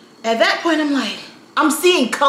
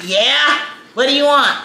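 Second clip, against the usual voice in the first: imitated crotchety grumble, slightly raised; grumpy old man; 3 semitones higher than usual. Old clerk answering his coworker, grumpy. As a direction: play an irritable old clerk. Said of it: "he's old and grumpy".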